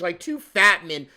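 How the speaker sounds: ordinary delivery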